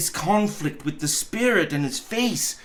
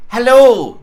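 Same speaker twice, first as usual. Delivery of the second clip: put-on boy, higher and louder; boy calling back; 6 semitones higher, 11 dB louder than usual. Boy in the street to Scrooge, calling up. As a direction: shout back up. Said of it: "returned the boy".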